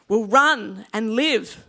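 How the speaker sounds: slow, loud call with a Scottish lilt; Scottish accent. Acted. Man in the crowd answering Wallace, slow loud Scottish call. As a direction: call out as a Scottish foot soldier. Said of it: "give my Scottish accent a go".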